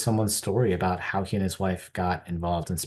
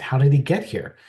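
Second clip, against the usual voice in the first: higher, louder disbelieving ask; amazed question; 7 semitones higher, 7 dB louder than usual. Retold or imitated. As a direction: ask it as if you can't believe it. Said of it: "I said".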